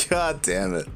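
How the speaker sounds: ordinary delivery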